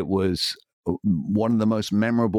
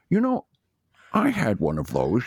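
imitating a Scottish film star reminiscing; high start dropping into the actor's Scottish burr; slushy-s Scottish actor impression; 4 semitones higher than usual. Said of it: "I met [name] and [name]".

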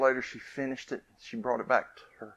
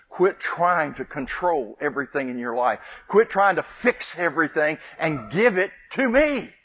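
forceful plea; 5 semitones higher, 8 dB louder than usual. Acted. Preacher voicing God's plea.

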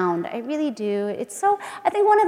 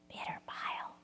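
whisper; 20 dB quieter than usual. Acted. Whispered line in poem.

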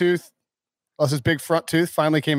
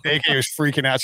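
normal talking voice

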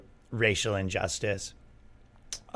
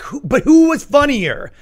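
much higher, louder, slow incredulous plea; exasperated; 13 semitones higher, 7 dB louder, slower than usual. Retold or imitated. Fed up with the woke-versus-based talk, higher, louder and slow. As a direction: throw up your hands at the whole debate. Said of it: "And you're like".

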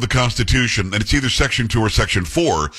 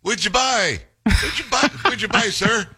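higher, badgering repeated question; hammed-up nagging press voice; mock reporter; 9 semitones higher, slower than usual. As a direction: parrot the pestering journalist. Mocking the reporter's question again.

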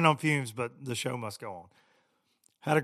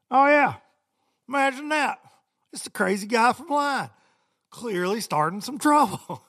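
sarcastic inner voice; 11 semitones higher, 9 dB louder than usual. Retold or imitated. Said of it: "I'm like".

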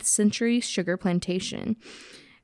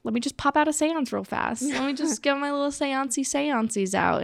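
lightly acted, higher-pitched breezy voice; hint of a silly séance dabbler; mock-casual visitor; 6 semitones higher than usual. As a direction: toss it off playfully, pitched up. Acts out someone casually holding seance.

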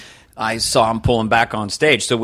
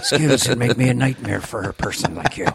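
Liam Neeson. Retold or imitated. Action hero warning an enemy, Liam Neeson voice. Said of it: "the Liam Neeson Irish".

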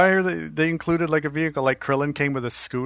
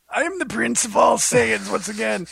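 raised, put-on gruff villain voice; dub villain voice; 7 semitones higher than usual. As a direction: ham up a proud cartoon prince. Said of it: "everybody has to sound like this".

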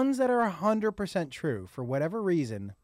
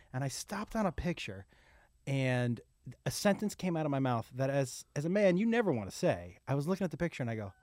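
usual speaking voice

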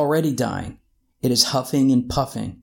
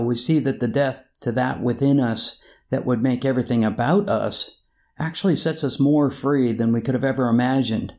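normal talking voice

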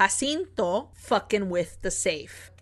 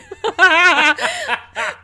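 loud laugh; 10 semitones higher, 11 dB louder than usual. Real, not acted. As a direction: burst of loud high laughter at herself. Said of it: "I read it".

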